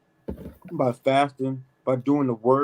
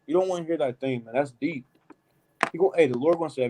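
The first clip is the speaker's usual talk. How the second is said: ordinary talk, nothing marked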